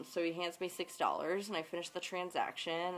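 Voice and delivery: ordinary talk, nothing marked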